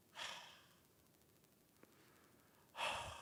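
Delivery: long breathy sigh; deep sigh. Acted. Preacher showing stifled believers' habitual sighing, deep sigh. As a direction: let out a long, helpless sigh.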